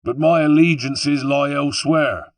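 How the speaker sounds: high, loud West Country sneer; taunting West Country villain. A narrator's reading. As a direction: scorn the suggestion in a West Country accent. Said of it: "he sneered".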